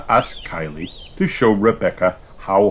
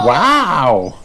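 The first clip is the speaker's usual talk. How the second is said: high, louder awed wow; amazed gasp; 7 semitones higher, 6 dB louder than usual. A narrator's reading. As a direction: gasp in wonder. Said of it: "gasp Peppa and George, amazed".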